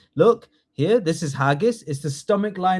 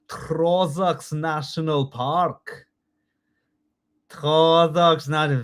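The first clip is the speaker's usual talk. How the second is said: slow Scottish accent with rolled r; slower than usual. Shown as a demonstration. Teacher drilling Scottish words with the class, Scottish accent. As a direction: stretch it out in Scottish. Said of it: "Give me your best Scottish accent".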